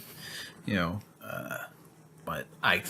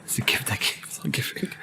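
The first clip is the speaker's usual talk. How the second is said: whispered joking punchline; 6 dB quieter than usual. Real, not acted. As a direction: hush it like a joke. Joking punchline after a yawn joke.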